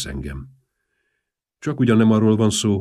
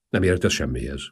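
plain reading voice

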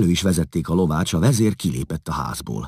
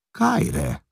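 echoing the greeting much higher, astonished; amazed echo. A narrator's reading. Priszkosz, stunned to hear his own language, echoes the greeting in amazement. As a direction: repeat it back in pure disbelief. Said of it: "ámul rá Priszkosz".

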